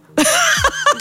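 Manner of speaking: high, bursting laugh of sudden recognition; burst of laughter. Real, not acted. Telling a friend how she finally recognised her doctor, bursting out laughing.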